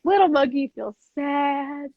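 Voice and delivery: slow, building storybook drama; hammed-up children's book performance; acted story reading. Shown as a demonstration. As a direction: draw out each feeling, growing into mock anger. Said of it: "I'll, like, act it".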